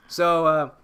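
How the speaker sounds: ordinary delivery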